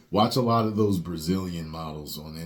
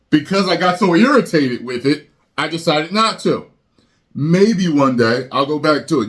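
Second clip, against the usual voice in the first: much higher and louder ranting; irritated rant; 11 semitones higher, 11 dB louder than usual. Real, not acted. Fed up with the Killmonger haircut, loud high rant.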